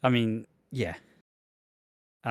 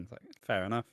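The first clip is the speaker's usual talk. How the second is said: ordinary talk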